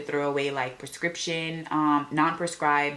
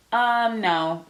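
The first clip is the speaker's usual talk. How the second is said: high, louder "um" dropping into a curt no; quick brush-off; 7 semitones higher, 4 dB louder than usual. Retold or imitated. Man brushing off her question about the building, quick and dismissive. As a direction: mimic a stranger's easy dismissal. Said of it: "Just quick and easy".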